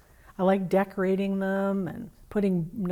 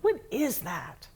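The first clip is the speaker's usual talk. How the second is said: startled; 3 semitones higher, 4 dB quieter than usual. A narrator's reading. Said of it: "And Deer jumped".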